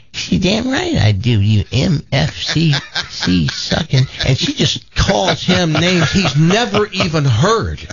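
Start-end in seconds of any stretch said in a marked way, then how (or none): 0.1-4.1 cursing granny. Acted. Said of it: "You think you could keep up".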